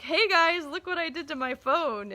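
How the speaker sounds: high and loud, bursting with glee; gleeful call to the kids; excited reveal. Retold or imitated. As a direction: show off the broken phone like a prize. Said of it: "kind of excited to show the kids".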